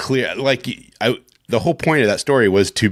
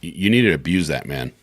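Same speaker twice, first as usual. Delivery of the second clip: ordinary talk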